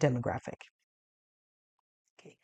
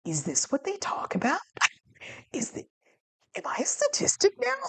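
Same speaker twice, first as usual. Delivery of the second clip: disbelieving inner thought; 10 semitones higher than usual. Retold or imitated. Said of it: "sitting there going like".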